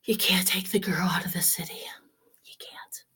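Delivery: soft unvoiced confession; confiding whisper to the audience; whispered aside. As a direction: let it slip like a secret.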